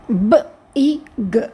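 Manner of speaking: "బ, ఈ, గ" as three separate sounds; sounded out. Shown as a demonstration. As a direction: break "బ, ఈ, గ" into short b, ee, short g. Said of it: "వీక్ సౌండ్స్కి ఒక vowel sound".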